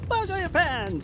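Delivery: put-on high old man yell. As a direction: screech it like an outraged grandpa. Old man scolding, said again.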